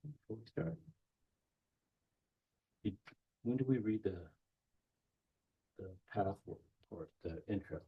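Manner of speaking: very soft, hushed question to the reader; hushed aside. Real, not acted. Facilitator quietly checks with reader about the intro, hushed. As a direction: lean in and ask quietly so the group won't hear. Aside during group ritual.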